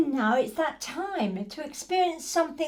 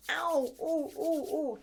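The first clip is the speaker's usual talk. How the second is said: high little pained ows and oohs; a hint of a cross, hurting toddler; mock pained ows; 3 semitones higher than usual. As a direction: play a toddler's sore cold hands. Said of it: "cross, or painful".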